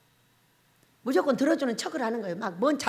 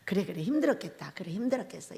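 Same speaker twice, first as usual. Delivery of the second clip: soothing husband; 7 semitones lower, 4 dB quieter than usual. Shown as a demonstration. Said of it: "이렇게 해주면 돼".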